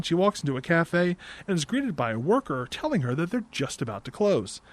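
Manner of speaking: ordinary talk, nothing marked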